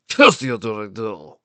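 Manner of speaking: slow, drawn-out villain's curse; villain's mild shout. A narrator's reading. Stallion cursing his nemesis, villain's mild shout. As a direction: draw out the curse like a serial villain. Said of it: "he shouted".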